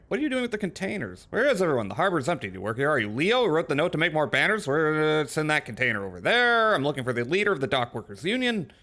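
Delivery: fast run through a list of questions; rapid skim-reading. Pretend. Player runs through dialogue options for Ubi, rapid read-out. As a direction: rattle the questions off in a hurry.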